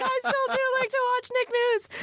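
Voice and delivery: very high, blubbering squeal; mock crying. Young adult confesses to TV host, imitating tearful blubbering. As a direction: wail it like a pretend crybaby. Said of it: "burst into tears".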